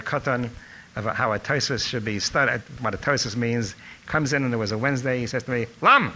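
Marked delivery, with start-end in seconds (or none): 5.8-6.1 sharp, high shout of a surname; imitated rabbi's call. Rabbi suddenly calls on a student in class, loud shout.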